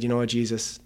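hushed, as if back on his knees; quiet retold prayer. Recalling his prayer of surrender to Jesus, softly.

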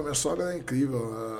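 ordinary talk

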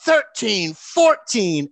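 put-on villain counting the kid's dribbles; counting out loud. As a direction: count it out like a ring announcer. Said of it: "DiBiase's counting".

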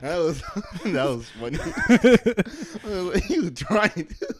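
high, loud laughter through the words; words barely squeezed out between laughs; laughing. Real, not acted. Cracking up at the impression.

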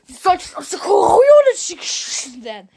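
loud, high, slurred shout; mumbled outburst. Real, not acted. Scolding fans who don't know the clan, mumbled shout. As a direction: blurt it out loud with the words smudged. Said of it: "Ich hab grad genuschelt".

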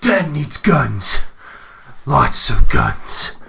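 slow, loud Keanu Reeves voice; Neo-style deadpan; Keanu Reeves impression. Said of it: "That's pretty good".

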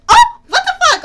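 high, loud mock shriek; a sudden high yelp of shock; shocked squeal. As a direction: squeal it out in disbelief. Retold shocked reaction.

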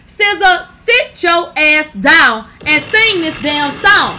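loud, slow scolding; sit her down, slow and loud; angry yell. Real, not acted. Yelling at [name], scolding.

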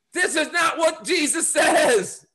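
high, slow, insistent shout; emphatic preaching shout. Real, not acted. Pastor rebutting end-times preachers, drawn-out high shout.

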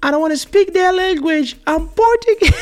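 very high mock tearful child; high, loud, hammed-up tantrum; starting to cry. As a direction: imitate a little girl wailing in protest. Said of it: "she was starting to cry".

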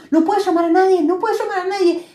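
high frantic complaint; worried phone struggle; panicked repetition; mock panic. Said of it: "está intentando".

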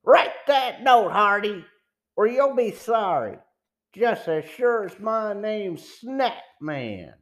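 villain's shouted ultimatum, easing off in volume; sudden shout. A narrator's reading. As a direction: shout the order at a stubborn captive. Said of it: "Then suddenly he shouted".

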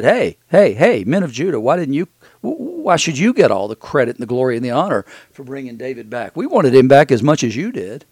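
loud retold call across a crowd; shouting out. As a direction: call out to the men of Judah. Retelling the men of Israel calling out.

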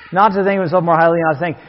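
rattled-off repeat of the verse; quick verse recap. Real, not acted. Preacher recapping the verse before a question, quickly. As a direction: run through the verse briskly in one go.

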